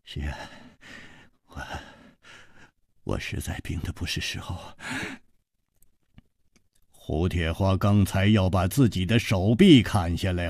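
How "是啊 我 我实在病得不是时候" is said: very soft, halting and feeble; faint, sickly, rueful murmur; rueful weak smile. A narrator's reading. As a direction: admit it weakly with a bitter smile. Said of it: "楚留香苦笑着说".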